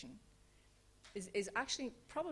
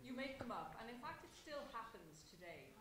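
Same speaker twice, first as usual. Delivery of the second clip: too quiet, off mic; 4 semitones higher, 10 dB quieter than usual. Real, not acted. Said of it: "Oh, sorry".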